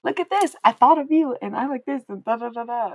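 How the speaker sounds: high, loud gushing child impression, fading into filler; imitated excited kid. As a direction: mimic a sweet kid showing off a find. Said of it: "she was like".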